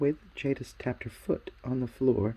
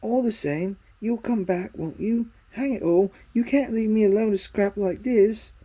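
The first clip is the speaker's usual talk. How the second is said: put-on boy's voice, higher and louder, urging; Diggory pleading; 8 semitones higher, 7 dB louder than usual. A narrator's reading. Diggory begging Polly to return, higher, louder plea. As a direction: beg her to come back soon.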